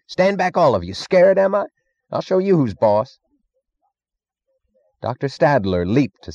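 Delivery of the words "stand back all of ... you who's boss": high, raised defiant shout; roaring bluster. A narrator's reading. Cuffy Meggs daring the whole room, roaring defiance. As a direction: crow loudly in a high pitch. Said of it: "roared Cuffy Meggs".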